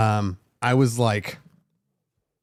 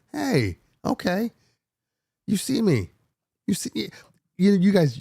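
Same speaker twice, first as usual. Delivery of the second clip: mock proud reply; 9 semitones higher than usual. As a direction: play it up, fishing for praise. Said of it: "So I was like".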